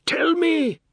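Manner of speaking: very high, loud scream. Acted. Husband screaming at his wife for an answer, scream. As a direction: scream the demand at her. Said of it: "he screamed".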